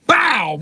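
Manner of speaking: high, loud bang; loud bow crash; mock crash noise. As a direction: hit it like an impact. Acting out ramming boats.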